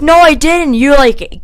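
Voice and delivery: high, loud mock protest; loud retold contradiction; retold indignant comeback. Retold argument, arguing back.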